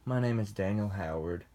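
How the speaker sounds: normal talking voice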